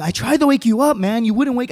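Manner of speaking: high, whiny self-justification; defensive excuse. Acted. Buddy excusing himself to handcuffed friend, defensive. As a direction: plead your case to a mad friend. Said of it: "trying to make the situation better by saying".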